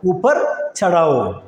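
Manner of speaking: ഊപ്പർ ചടാവോ slowly, each syllable drawn out; slow repeat. Shown as a demonstration. Teacher slowly repeats ഊപ്പർ ചടാവോ for the class.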